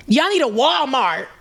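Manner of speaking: high mock yell. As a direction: imitate the boyfriend yelling at the bartender. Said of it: "just yelling".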